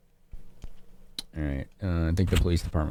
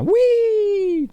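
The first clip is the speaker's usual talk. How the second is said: excited squeal; 4 dB louder than usual. Real, not acted. Joyful exclamation at game start.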